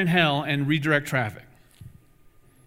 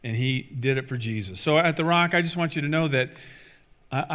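ordinary talk

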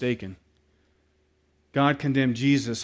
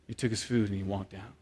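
ordinary talk, nothing marked

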